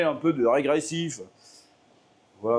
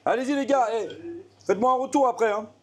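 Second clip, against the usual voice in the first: calling out; 6 semitones higher than usual. Real, not acted. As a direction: call out to the room, raised. Calling to friends eating.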